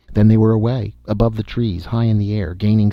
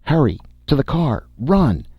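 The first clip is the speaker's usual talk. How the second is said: raised, urgent shout of hurry; shouting to run; 6 semitones higher than usual. A narrator's reading. Escaping man yells to his friends, urgent shout. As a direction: yell at friends to get to the car. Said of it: "he shouted to Mara and Jan".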